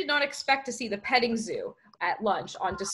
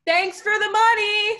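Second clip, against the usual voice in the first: high, loud, drawn-out taunt; slow high-pitched yell; yelling back; 12 semitones higher, 9 dB louder than usual. A narrator's reading. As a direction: call it back across a distance. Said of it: "Sierra yelled back".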